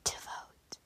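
hushed nervous whisper. Real, not acted. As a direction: barely breathe the words out. Tense voting moment in game.

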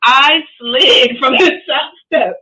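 laughing. Real, not acted. Laughing through fall story.

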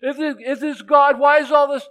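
high, loud whiny complaint; mock complaining. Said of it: "they complain, they say".

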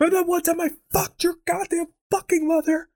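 high-pitched acted tirade; sudden hammed-up angry eruption; angry outburst. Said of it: "I don't want him to be angry".

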